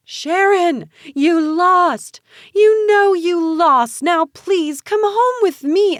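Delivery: high, loud, drawn-out pleading shout; slow, high-pitched anguished yell; desperate shouting. A narrator's reading. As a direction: yell frantically to a sister. Frantic sister shouts while dragged away.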